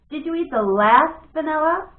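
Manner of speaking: accusing. Acted. Brother accusing.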